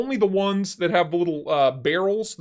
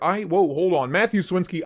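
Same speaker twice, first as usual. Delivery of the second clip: ordinary talk, nothing marked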